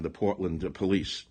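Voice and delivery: ordinary talk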